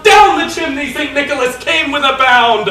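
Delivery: usual speaking voice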